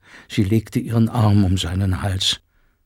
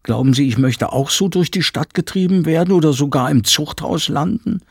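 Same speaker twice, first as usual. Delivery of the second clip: louder, higher voice of a housekeeper spelling out bad news; urgent housekeeper; 7 semitones higher, 4 dB louder than usual. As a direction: announce the new law with alarm. Frau Penck fearful outburst.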